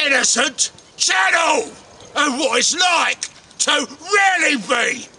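slow, stretched-out announcer delivery; big theatrical welcome, word by word; hammed-up show host intro. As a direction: announce it grandly, lingering on key words.

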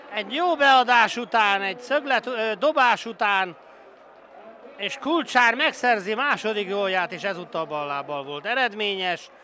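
screaming goal call, high and loud; goal shout. Real, not acted. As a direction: roar it out in triumph.

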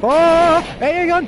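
sudden loud high call; excited callout. Real, not acted. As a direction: shout it out as you spot it. Spotting a gun mid-game.

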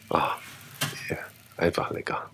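soft breathy whisper of delight. Real, not acted. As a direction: whisper it like a tasty secret. Tasting the bread, savoring it.

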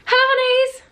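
high, loud sing-out hello; over-bright channel opener; vlog intro greeting. Shown as a demonstration. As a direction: put on a big bubbly intro voice. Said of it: "can't even do my own intro".

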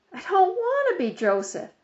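whiny protest. A narrator's reading. Boy protesting to father.

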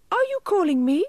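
very high, loud little elf; high-pitched elf calling back; tiny silvery voice. A narrator's reading. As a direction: pipe up in a tiny, silvery shout. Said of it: "he shouted in a tiny silvery voice".

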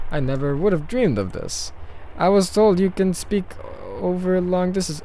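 put-on wondering Russian-accented character voice. Leos amazed by long-distance talk, Russian accent. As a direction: marvel in an acted Russian accent. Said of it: "I'm doing a Russian accent".

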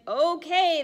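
high, loud chorus; cheerful chorus reply; penguins agreeing. Acted. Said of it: "they reply".

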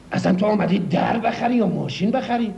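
normal talking voice

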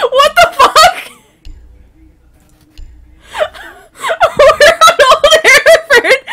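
high, loud, laughing in disbelief; squealing with laughter, near tears; shrieking laughter. Real, not acted. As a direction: cackle it out, can't believe it.

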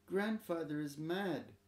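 put-on mild tearful child telling on grandfather; lightly voiced scared little boy; sobbed. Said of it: "sobbed Tada".

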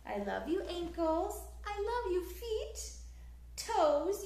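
ordinary delivery, nothing marked

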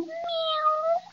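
high, soft mew; a tiny plaintive mew; small kitten meow. Acted. Kitten reminding them it's there.

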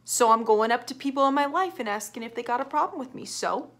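ordinary talk, nothing marked

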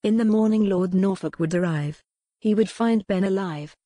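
usual speaking voice

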